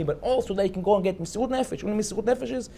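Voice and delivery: usual speaking voice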